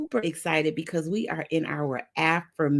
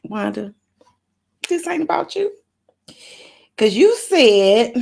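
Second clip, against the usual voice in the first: self-scolding; 7 semitones higher, 7 dB louder than usual. Retold or imitated. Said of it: "I have to just say".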